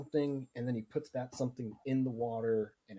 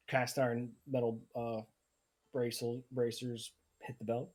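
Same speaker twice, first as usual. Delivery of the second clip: usual speaking voice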